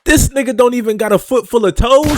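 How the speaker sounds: high, loud incredulous outburst; loud disbelief. Real, not acted. Commentator reacting to news of missing toes, incredulous shout. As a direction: blurt it out loudly in real disbelief.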